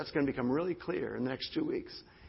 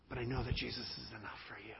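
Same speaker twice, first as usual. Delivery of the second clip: whisper; 2 semitones lower, 6 dB quieter, faster than usual. Real, not acted. Pastor's intimate reassurance.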